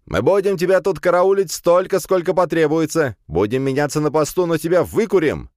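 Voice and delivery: high, loud threatening shout; yelling. A narrator's reading. Classmate vowing to smoke Firs out, yelling. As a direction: holler a vow through the door. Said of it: "вновь заорал Вешкин".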